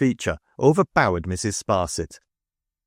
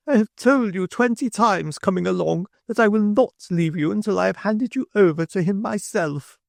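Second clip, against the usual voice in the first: triumphant Mrs Sparsit; 12 semitones higher than usual. A narrator's reading. Mrs Sparsit calling into the coach, high and triumphant. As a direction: crow it in a much higher voice.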